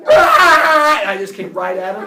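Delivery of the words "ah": long, high full-volume battle scream; screaming attack cry. Retold or imitated. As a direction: scream like a charging animal. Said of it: "and I screamed".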